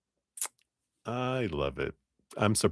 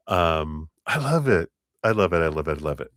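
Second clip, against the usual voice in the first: usual speaking voice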